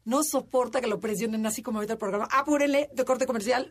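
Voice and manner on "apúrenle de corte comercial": high, loud mock hurry-up; rushing someone. Producer rushes hosts before the commercial, loud and high. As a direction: imitate a producer pushing for the break.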